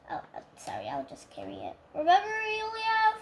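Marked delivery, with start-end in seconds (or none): 0.1-1.8 sheepish whisper; whispered apology. Pretend. Puppet quietly apologizing to its friend after swearing, hushed whisper. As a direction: breathe the sorry out so nobody hears.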